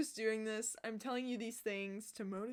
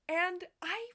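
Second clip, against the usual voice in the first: intense excitement; 11 semitones higher, 5 dB louder than usual. Real, not acted. Vlogger gushing to viewers about dream boots, intense excitement. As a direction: stretch it out with fierce, high-pitched passion. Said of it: "sorry, I get intense".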